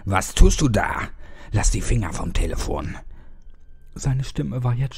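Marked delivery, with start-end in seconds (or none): 0.0-3.0 loud, harsh bark; barking at him. A narrator's reading. Fat man barks at the boy to drop the phone. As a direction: snap the order at him. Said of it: "herrschte ihn an".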